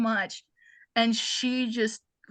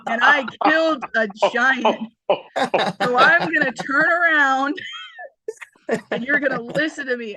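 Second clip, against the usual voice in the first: voicing Ellie as a bossy leader; 7 dB louder than usual. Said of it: "and she's like".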